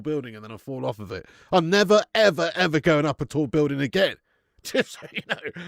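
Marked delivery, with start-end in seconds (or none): 1.5-4.2 loud, vehement vow; insistent and forceful; emphatic refusal. Real, not acted. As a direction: swear it off loudly, leaning on never. Vehement vow about fear of heights.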